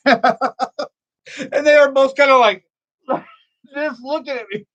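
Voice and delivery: slow, high, laugh-filled words; laughing while talking. Real, not acted. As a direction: talk through the laughter. Laughing while telling the story.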